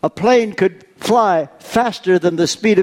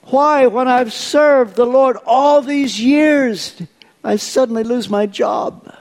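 anguished questioning; 6 semitones higher than usual. Retold or imitated. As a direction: cry out why like a hurting believer. Voicing a believer's anguished questions.

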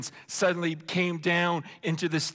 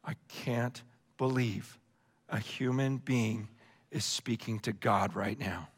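much lower and softer, breathless awe; awed hush; 10 semitones lower, 6 dB quieter than usual. Retold or imitated. Crowd at the mountain watching Moses speak to God, awed hush. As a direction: hush it like you can't believe your eyes. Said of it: "all the people are looking on going".

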